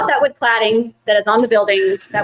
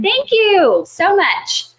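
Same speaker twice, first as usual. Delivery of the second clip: high, slow, gushing thank-you; drawn-out grateful gush; 10 semitones higher, much slower than usual. Real, not acted. Thanking a commenter.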